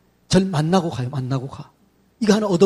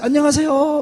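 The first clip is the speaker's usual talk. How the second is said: bright greeting; 6 semitones higher than usual. Shown as a demonstration. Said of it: "막 이렇게 하는 거예요".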